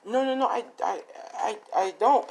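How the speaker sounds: high, stammering mock denial; acting caught out and flustered. Voicing her flustered denial.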